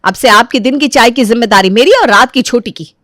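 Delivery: lively voiced daughter-in-law objecting politely; protesting daughter-in-law. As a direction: plead your case to mother-in-law.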